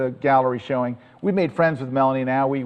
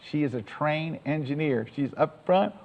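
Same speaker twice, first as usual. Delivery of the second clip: ordinary talk, nothing marked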